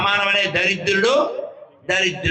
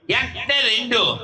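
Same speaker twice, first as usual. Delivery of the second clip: higher, drawn-out schoolboy recital; childhood counting mimicry; 5 semitones higher, slower than usual. Pupil counting numbers for the master, in slow higher mimicry. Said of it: "చిన్నప్పుడు మిమిక్రీలో విని చేసింది".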